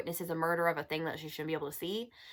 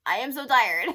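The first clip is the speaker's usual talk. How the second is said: higher, louder I am so tired with a rising end; mock exasperated wail; 6 semitones higher, 8 dB louder than usual. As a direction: throw it up like a complaint. Fed up with love-triangle trope.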